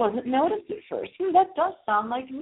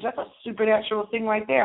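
normal talking voice

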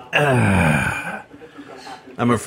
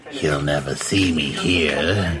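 cat's thoughts voice; 6 semitones lower, slower than usual. Pretend.

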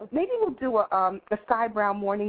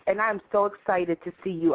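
normal talking voice

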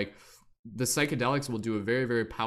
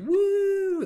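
high, loud gleeful whoop; 8 dB louder than usual. Retold or imitated. Careless tripper spilling the gold, wild whoop. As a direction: let out a wild cheer.

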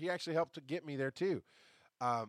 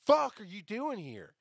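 retold angry reaction; 8 semitones higher, 6 dB louder than usual. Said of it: "my first reaction is like".

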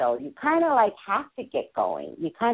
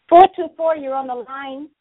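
calling out; 6 semitones higher, 7 dB louder than usual. Real, not acted. Host calling out to caller.

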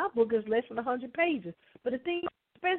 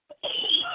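cough; 6 semitones higher than usual. Real, not acted. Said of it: "Excuse me".

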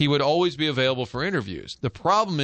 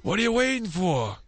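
overcoaching dad; 7 semitones higher than usual. Retold or imitated. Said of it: "I'm overcoaching".

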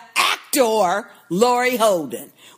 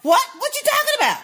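high, disbelieving what; mock a baffled man, pitch jumping up; incredulous outburst; 11 semitones higher, faster than usual. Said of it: "He gonna say".

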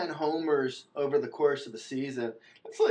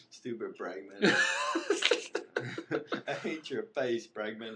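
laughing; 2 semitones lower, 4 dB quieter than usual. Pretend. Mock grudge, laughing.

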